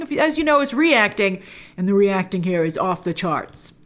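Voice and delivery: usual speaking voice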